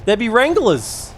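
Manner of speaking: high, loud, slowed mock warning; mock pirate alarm. Warning of flame wranglers in the game, mock alarm. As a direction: cry out the danger like an old sailor.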